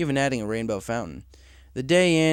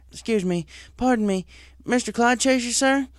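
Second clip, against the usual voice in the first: Applejack's voice, higher, calling politely; 11 semitones higher than usual. Acted. Applejack galloping up, calling to the weather director. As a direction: flag him down from a distance.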